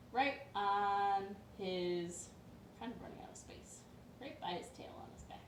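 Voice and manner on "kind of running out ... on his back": quick hushed aside; whispered aside. Real, not acted. Storyteller commenting on the drawing, whispered quickly.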